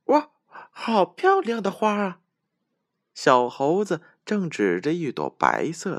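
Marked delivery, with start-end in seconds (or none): 0.1-2.2 high, delighted little monkey; excited monkey voice. Acted. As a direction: gasp at the flower in a high voice.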